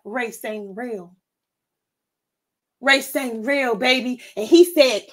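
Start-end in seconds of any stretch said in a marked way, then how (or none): 0.0-1.1 very soft and slow, almost under the breath; hushed aside. Real, not acted. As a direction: drop the voice to a slow murmur. Aside musing on race.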